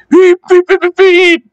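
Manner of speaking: high piercing engine whistle beeps. Acted. Edward calling his late passengers with a high whistle. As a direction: beep like a steam engine whistle.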